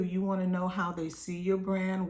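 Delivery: ordinary delivery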